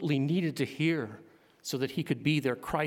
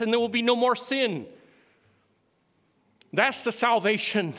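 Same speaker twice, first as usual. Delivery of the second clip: full-force preaching, louder and higher; rising to a fervent pulpit climax; 6 semitones higher, 7 dB louder than usual. Real, not acted. Preacher lifting the congregation toward glory, in full-force preaching. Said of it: "I got preaching there a bit".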